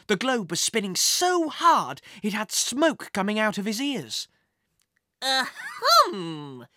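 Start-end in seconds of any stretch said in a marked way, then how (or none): 5.2-6.7 theatrical throat-clearing cough; exaggerated little "ahem". A narrator's reading. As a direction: clear your throat for attention. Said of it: "coughed Wizzy".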